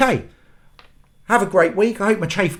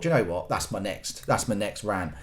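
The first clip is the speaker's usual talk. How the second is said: inner thought; 10 semitones lower, 10 dB quieter than usual. Retold or imitated.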